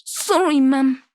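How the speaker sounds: put-on sheepish boy saying sorry; boy apologizing. Boy apologizes to old lady.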